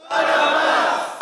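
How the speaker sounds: crowd shout. Pretend. Preacher playing the crowd, shouting for Barabbas. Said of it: "you must scream".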